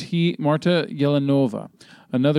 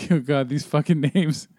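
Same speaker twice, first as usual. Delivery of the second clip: usual speaking voice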